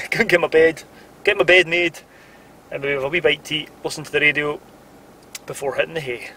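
usual speaking voice

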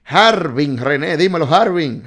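excited shout-out; calling out. Real, not acted. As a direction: call the viewer out by name. Calling out a viewer's name.

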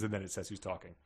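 ordinary talk

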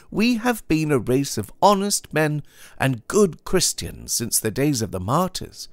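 high, insistent protest from a pious goodman; indignant young Puritan. Acted.

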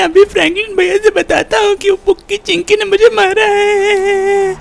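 hammed-up, high tearful whine; crying. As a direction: wail out the complaint, trailing long sobs after phrases. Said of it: "इतना रो क्यों रहा है".

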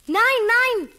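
alarmed shout. Acted. Eldest kid warns the others.

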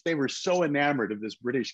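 normal talking voice

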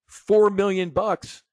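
high, loud shocked question; can't believe the loss, voice jumping up; stunned disbelief. Retold or imitated. To the fired trader, asking about the loss: shocked, high and loud.

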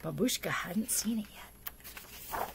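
slow secret whisper. Acted. Storyteller hushing the children before the reveal, whispered. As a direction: lean in and whisper it slowly, like a secret.

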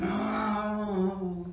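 loud, drawn-out moan; monster moan. A narrator's reading. Reader sounding the monster in the cellar, moaning. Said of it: "And something's going".

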